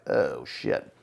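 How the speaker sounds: a low, quiet "oh, shit" under the breath; dismayed inner voice. Retold or imitated. The new parent, as the baby arrives, mutters low and dismayed. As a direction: mutter it as the dread sinks in. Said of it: "you're like".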